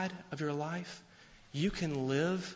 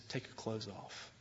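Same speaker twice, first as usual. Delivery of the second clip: menacing low threat; 5 semitones lower, 12 dB quieter than usual. Retold or imitated. Attacker's threat in retold assault.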